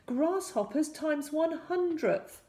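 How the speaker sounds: high, drawn-out incantation; casting a spell. A narrator's reading. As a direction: proclaim it slowly as a magic spell. Hero casts a spell.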